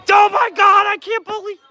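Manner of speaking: high, loud gasp of disbelief; shocked shout. Real, not acted. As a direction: blurt it out as the ball goes in. Stunned by a goal, shouting.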